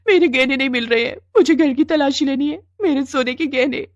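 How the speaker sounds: high, whining tearful complaint; fake crying wail. A narrator's reading. Wife framing mother-in-law, put-on crying. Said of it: "Gita ने रोना धोना शुरू कर".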